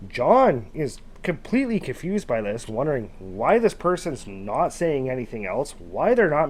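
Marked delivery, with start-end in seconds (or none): none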